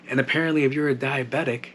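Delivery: ordinary talk, nothing marked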